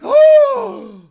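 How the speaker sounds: high, loud gasp of joy; loud delighted gasp. Real, not acted. Streamer gasping with joy as Mari brings a sandwich. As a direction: gasp out loud as if given a gift.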